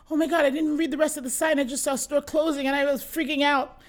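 imitating a friend's breathless relief; flustered relief. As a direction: gush it out like a friend who just panicked. Friend retelling her panic at sign.

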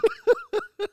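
squealing laugh, very high. Real, not acted.

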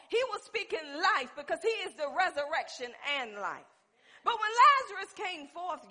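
loud, high-pitched pulpit delivery; full-force preaching. Real, not acted. Preacher telling people their lifestyle must match belief, forceful preaching.

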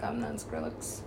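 속삭이는 항변; whispered aside. Retold or imitated. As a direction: breathe it out under the voice. Retold protest.

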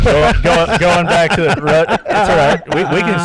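high laughing restart. Real, not acted. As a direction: laugh through the words.

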